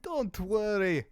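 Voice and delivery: put-on a vague Eastern European merchant accent, lightly done; sly salesman voice with an unplaced European accent; mystery European accent. Shady merchant reassuring a customer, in a put-on European accent. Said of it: "don't ask me what this accent is".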